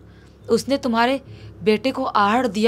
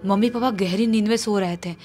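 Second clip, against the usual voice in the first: ordinary delivery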